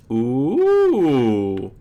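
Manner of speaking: long ooh swooping up and down; drawn-out, rising-then-falling ooh; intrigued ooh. Real, not acted. Reacting while playing.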